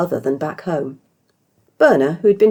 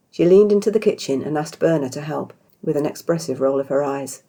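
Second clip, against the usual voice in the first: ordinary delivery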